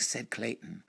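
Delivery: plain reading voice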